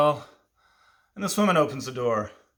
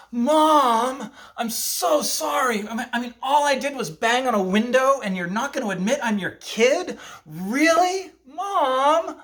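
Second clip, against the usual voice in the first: put-on much higher, louder wounded kid voice; pouring it on; 12 semitones higher, 5 dB louder, slower than usual. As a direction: lay the guilt on thick as the hurt child. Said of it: "So I had to pour it on".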